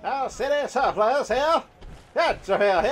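slow, high hawker's cry; street vendor call. Pretend. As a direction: call out wares like a market seller.

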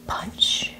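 normal talking voice

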